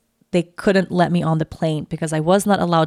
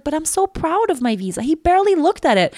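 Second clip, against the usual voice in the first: high, pouty complaint; whiny friend; 9 semitones higher than usual. Retold or imitated. Maya sulking that her visa went unchecked, pouty. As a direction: pout like a hurt friend.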